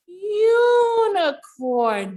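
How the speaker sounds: unicorn stretched slow, high and loud; drawn-out word. Shown as a demonstration. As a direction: say unicorn slowly and proudly. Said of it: "Do you see the letter U".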